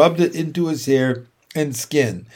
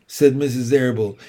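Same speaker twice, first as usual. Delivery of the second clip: ordinary delivery, nothing marked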